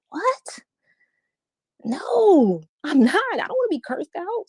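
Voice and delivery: high, shocked what; incredulous. Retold or imitated. Answering the ladies, high incredulous protest. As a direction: recoil in disbelief, pitch jumping up.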